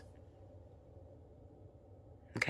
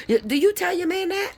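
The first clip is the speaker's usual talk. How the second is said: incredulous challenge. Real, not acted. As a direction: pitch leaps up on the question. Pointed challenge, voice jumps.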